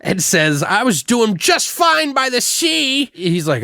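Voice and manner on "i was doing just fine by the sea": high, drawn-out mock protest; hammed-up high-pitched retort; mock protesting woman. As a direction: imitate an indignant woman in a higher voice. Voices the woman in film quarrel.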